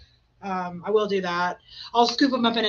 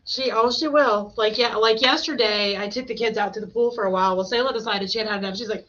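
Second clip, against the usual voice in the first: normal talking voice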